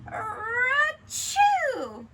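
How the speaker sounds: loud, high, hammed-up sneeze; big sneeze. Teacher reading to kids, the bear's loud sneeze. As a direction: blast out a giant bear sneeze.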